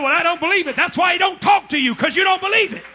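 shouted, hammering preacher cadence; fiery revival preacher shouting; preaching at full force. Acted. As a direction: drive it home to the congregation.

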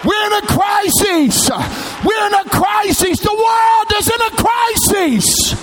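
high, shouted pulpit cry with drawn-out words; full-force preaching. Real, not acted. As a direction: preach it out at the top of your range.

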